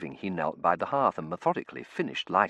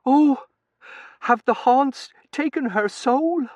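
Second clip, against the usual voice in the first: high, loud, slow maid voice; superstitious maid's slow wail; alarmed maid; 11 dB louder, slower than usual. A narrator's reading. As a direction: cry out in high-pitched alarm.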